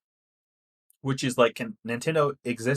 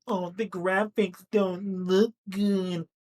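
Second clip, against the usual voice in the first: high, drawn-out mock whine; exaggerated slow complaint in a put-on voice; mocking complainer; 7 semitones higher, much slower than usual. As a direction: imitate a fussy fan griping, slowly. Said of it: "one of those people that's like".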